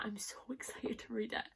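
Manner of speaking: hushed, breathy whisper of excitement. Real, not acted. Booktuber whispering her excitement to viewers. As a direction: whisper it like a secret thrill.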